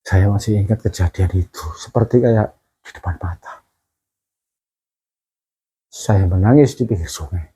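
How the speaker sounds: low, halting voice with long pauses; close to tears. Real, not acted. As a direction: hold back real tears while remembering. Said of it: "ini saya ceritain sampai kepingin nangis saya". (suara rendah menahan tangis)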